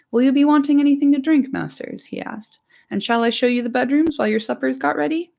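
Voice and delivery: keen to please; eager hobbit servant. Acted.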